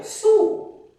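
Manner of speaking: "Sul" high and loud with a dark back oo; model Sul. Shown as a demonstration. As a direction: sing out the word Sul. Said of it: "Oh, really?".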